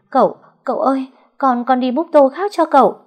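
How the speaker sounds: high, loud stammering plea; terrified crying plea. Acted. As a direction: beg the master through tears. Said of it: "Con Sen khóc thét lên".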